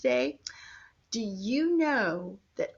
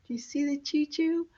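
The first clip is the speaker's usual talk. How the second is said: usual speaking voice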